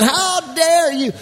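high, loud, slow "How dare you?"; a hammed-up outraged policeman waving his gun; enraged cop. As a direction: blow up like a cop gone crazy. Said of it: "grabs his gun and he's waving it around".

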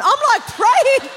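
high, loud, drawn-out exclamation; hammed-up eager surrender; mock fervent eagerness. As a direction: stretch it out loudly for comic effect. Acts out fervently going along.

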